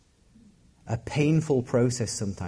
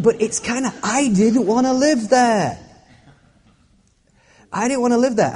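hammed-up sulk about not wanting to live there; mock whining; 10 semitones higher, 6 dB louder than usual. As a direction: whinge like a reluctant kid about the cave. Pastor reenacting his own complaint.

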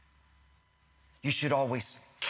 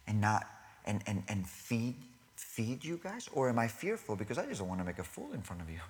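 ordinary talk

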